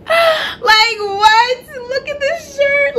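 real fangirl squeal over the shirt; excited squeal. Real, not acted. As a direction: gasp then high squealing disbelief. Overwhelmed by favourite shirt.

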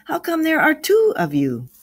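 high, loud mock puzzlement; joking. A narrator's reading. Grandfather teases his two arriving grandchildren, joking. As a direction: tease them with a high-pitched question. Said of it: "replied Samuel jokingly".

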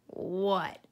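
usual speaking voice

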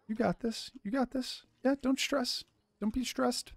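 high, soft cooing; baby talk to cow. Pretend. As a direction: coo to a nervous pet.

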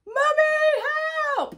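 put-on high, loud little girl's yell for help; playful imitation of a daughter calling her mum; child crying for help. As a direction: shout it like a child dangling from the monkey bars. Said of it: "she was like".

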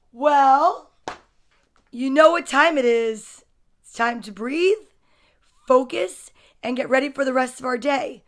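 usual speaking voice

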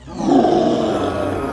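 low, loud breathy monster roar; dino roar. Pretend. Roaring dinosaur for a child, low and loud. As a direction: roar deep like a big dinosaur.

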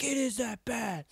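high-pitched mock dad retort; imitating yelling dad. Dad arguing after smashing the vape, imitated angry retort. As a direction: argue back as the angry father. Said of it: "he's still yelling at me".

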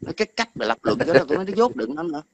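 squeaky derisive put-down; mocking contempt. Real, not acted. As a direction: sneer at his stupidity. Ridiculing a rival's stupidity.

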